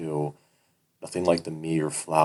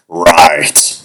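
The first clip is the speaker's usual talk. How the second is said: mock laugh; 15 dB louder than usual. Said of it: "the man laughs".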